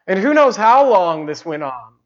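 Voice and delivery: ordinary delivery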